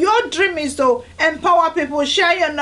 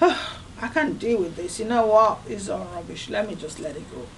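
sighing, resigned and fading softer; weary giving up; 6 semitones lower, 6 dB quieter than usual. Retold or imitated. Resigned sigh to yourself about a hurt. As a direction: let it all drop with a tired sigh. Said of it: "Or are you gonna say".

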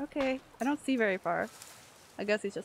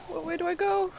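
high, loud panicked cry; real panic; 10 semitones higher, 5 dB louder than usual. Real, not acted. Player lost as pursuers close in, panicked. Scared player fleeing in horror game.

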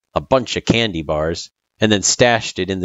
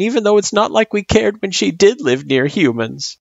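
put-on high, grumbling child voice; 14 semitones higher, 4 dB louder than usual. A narrator's reading. Girl complaining to her friend about meddling humans, grumbling. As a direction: grumble it out like a put-upon kid. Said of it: "she grumbled".

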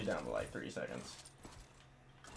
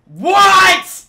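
very high, very loud what; shrieked disbelief; 20 dB louder than usual. Real, not acted. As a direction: shriek it at the screen. Gamer fails.

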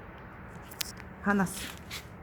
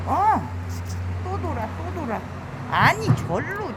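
high, loud alarmed calls; fed-up shouts at the turning beetle; exasperated cries; 9 semitones higher, 6 dB louder than usual. Real, not acted. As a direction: yell as it turns round once more. Beetle turns back again.

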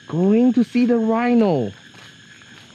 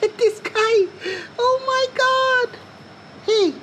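squealing delight. Real, not acted. Delighted at small animal.

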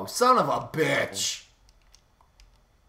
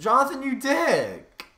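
slow, high, loud outraged yell; angry shout; 11 semitones higher, 6 dB louder, slower than usual. Real, not acted. Player yelling at Jonathan who blew him up, slow high outraged shout. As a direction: hurl the insult up high and drawn out. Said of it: "The way he said dick".

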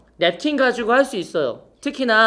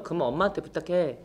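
mock curt reply; 4 semitones lower, 8 dB quieter than usual. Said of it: "농담이에요, 농담이에요".

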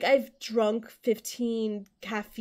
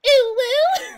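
loud, very high uwu squeal; playful high-pitched catchphrase; cutesy squeal; 10 dB louder than usual. Pretend.

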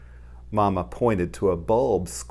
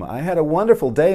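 higher, louder, fast and delighted; happy exclamation; 7 semitones higher, 9 dB louder, faster than usual. Acted. Little mole telling Mama about his day, delighted. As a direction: burst out with joy.

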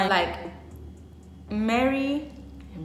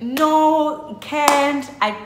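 upset best friend; 5 semitones higher, 5 dB louder, slower than usual. Retold or imitated. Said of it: "My best friend starts crying".

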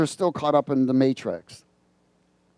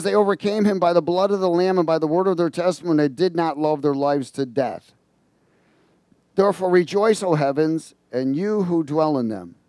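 scripture reading; 3 semitones higher, 3 dB louder than usual. Acted. Reads scripture aloud.

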